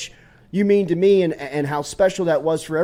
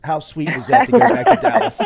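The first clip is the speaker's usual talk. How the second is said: laughing; 6 semitones higher, 6 dB louder than usual. Real, not acted. Laughing over cowboy hat song.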